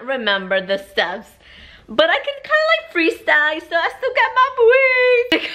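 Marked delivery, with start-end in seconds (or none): 1.9-5.6 high sing-song bragging; playful brag. Real, not acted. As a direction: show off playfully.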